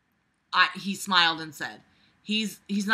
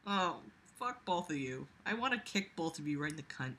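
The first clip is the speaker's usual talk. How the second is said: soft, fast muttering; quiet jealous mutter; muttered grumble; 3 semitones lower, 11 dB quieter than usual. Real, not acted. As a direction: grumble it under your breath.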